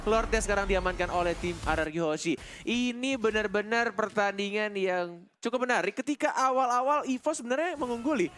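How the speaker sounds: ordinary delivery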